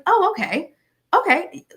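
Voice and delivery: high, bright feigned "oh, okay"; mock surprise. Feigning delight at their answer.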